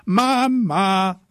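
high, loud, drawn-out yell; child hollering for help, stretched out; yelling for mum. A narrator's reading. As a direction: scream for mum across the house. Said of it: "schrie ich".

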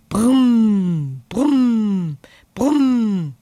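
loud buzzing brumm, fading to a breathy buzz. Acted. Bumblebee buzzes loudly as it lifts off. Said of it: "Ein lautes Brummen ertönt".

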